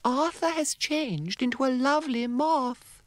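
very high, gentle coo; cooing fairy. Acted. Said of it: "cooed Mavis".

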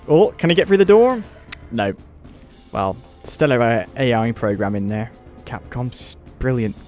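normal talking voice

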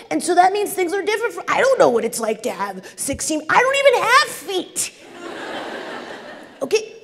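high, put-on snail voice. Snail pleading with caterpillar to get under fence, high put-on voice. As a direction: pitch it way up as the gentle snail.

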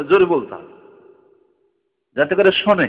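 ordinary talk

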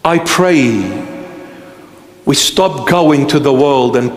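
low, steady litany of I pray, fading at the end; preaching prayer. Acted. Pastor praying over his beloved congregation, low and fading.

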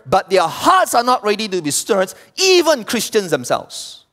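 high-pitched, insistent sermon; forceful preaching. Real, not acted. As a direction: drive the point home from the pulpit.

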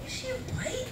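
high, soft disbelieving voice; surprised question. Retold or imitated. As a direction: ask it up high and hushed, in disbelief. Friend on phone asks about her.

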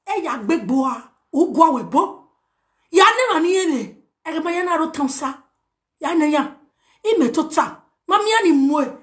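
ordinary talk

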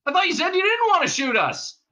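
high, loud protest; calling out. A narrator's reading. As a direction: shout a complaint across to the shooters. Zaphod calls out to cops.

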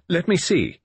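high, loud curt demand; peremptory. Acted. Narrator demands the field glasses, curt and peremptory. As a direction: snap out an order. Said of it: "My voice was peremptory".

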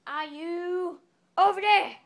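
slow, loud, high holler; calling out. Pretend. Searching for a missing friend, slow loud call. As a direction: stretch the call out slowly and loudly.

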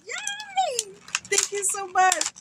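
very high, squealed thanks; gleeful squeal. Real, not acted. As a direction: squeal it out with delight. Thanking sender for a gift.

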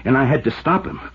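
rising to a loud, high, desperate shout; a cornered man justifying himself; from firm to loud and frantic; building outburst. Acted. Alex's cornered confession rising.

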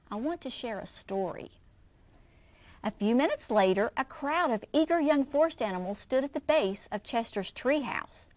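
put-on lively read-aloud for children; expressive picture-book reading; story narration. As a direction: read it like story time for kids.

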